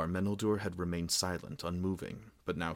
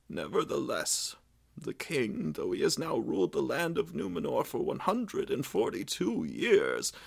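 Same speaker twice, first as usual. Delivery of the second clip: aged king's voice pitched higher, sorrowful; king answering his angry son, higher and grieved; grieving old king; 8 semitones higher, 3 dB louder than usual. A narrator's reading. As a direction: speak as a weary, grieving father-king. Meneldur speaks as king.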